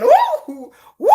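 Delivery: high, loud party whoops; excited whoop. Retold or imitated. Drunk storyteller reliving the fall, whooping high and loud. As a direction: cheer like a tipsy girl mid-fall.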